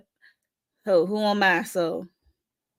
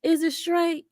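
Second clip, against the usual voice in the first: sceptical mock question; 8 semitones higher, slower than usual. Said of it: "I'm like".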